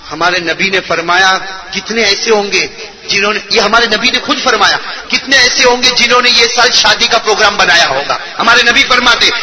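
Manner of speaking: ordinary talk, nothing marked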